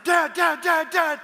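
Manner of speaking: high, loud repeated calls of Dad; a kid bursting in, yelling for his father; excited child shouting for dad; urgent kid calling. Retold or imitated.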